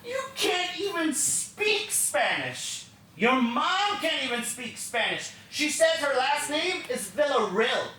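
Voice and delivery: mock the child cruelly, then shout the order; mocking stepmom. Stepmom taunting a child about Spanish, high sneer building to shout. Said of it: "She's like".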